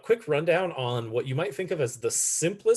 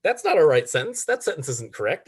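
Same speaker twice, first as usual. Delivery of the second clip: higher, louder mock protest; acted indignant correction; automatic objection; 5 semitones higher, 6 dB louder than usual. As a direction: object at once, as if catching a mistake. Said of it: "like, automatic response".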